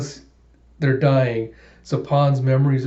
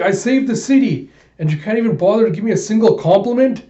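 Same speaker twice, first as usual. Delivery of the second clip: much higher and louder, fast enraged yell; furious shout; 8 semitones higher, 6 dB louder, faster than usual. Acted. Alita thrown into the shaft, yelling at the robots in fury. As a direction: scream your outrage at the ungrateful robots.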